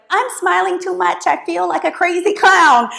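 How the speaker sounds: high, mock-anxious inner thought; self-conscious inner voice. To a client, voicing the fear of over-smiling, high mock worry. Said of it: "It may feel like".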